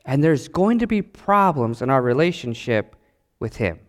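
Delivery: ordinary talk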